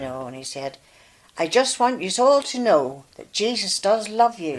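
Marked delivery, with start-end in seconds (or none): 1.4-4.6 put-on raised, higher child's announcement; boy telling the whole bus, louder and higher; child calling out. Wee boy on the bus telling everyone, raised voice.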